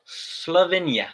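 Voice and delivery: usual speaking voice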